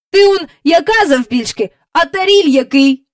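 put-on high, loud boyish ridicule; mocking, punchy exclamation; scoffing. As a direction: throw it at her as obvious nonsense. Michael scoffs at Jane's story.

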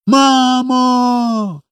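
put-on high, long-held child's cry; shouted call. A narrator's reading. Little boy shouts to his mother in the sky. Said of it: "крикнув Коля".

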